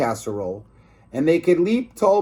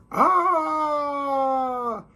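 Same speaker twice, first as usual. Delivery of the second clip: high far-off scream; 12 semitones higher than usual. Acted. Hero crying out as the ladies attack, high scream. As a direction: let out a high shriek.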